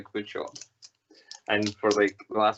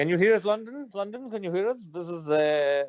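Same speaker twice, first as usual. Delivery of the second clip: high mock radio hail; radio operator; 7 semitones higher than usual. Mock radio call to London.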